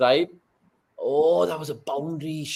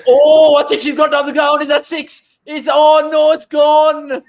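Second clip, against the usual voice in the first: much higher and louder reaction; excited shout; 11 semitones higher, 9 dB louder than usual. Real, not acted. Fan reacting live as the batter is out, shouting. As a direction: cry out as the ball flies and the wicket falls.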